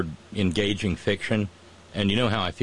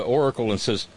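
normal talking voice

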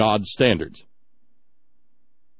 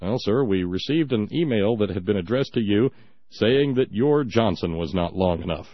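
lightly voiced newsman; 6 semitones lower than usual. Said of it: "see Brian Williams ask John Edwards".

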